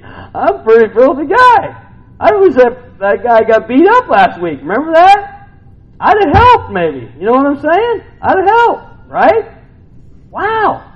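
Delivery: loud, high preaching; preaching at full force. Acted. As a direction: confess it loudly to the crowd.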